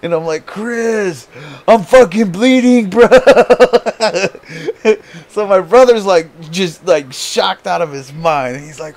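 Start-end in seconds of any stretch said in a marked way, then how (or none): 0.4-3.1 high, loud fake-panic cry to his brother; hammed-up alarm, like he's badly hurt; mock panicked yell. Fake bleeding prank on brother. 3.1-5.2 real, high, loud laugh breaking out; can't hold the laugh in; loud burst of laughter. Real, not acted. Laughing at his own prank.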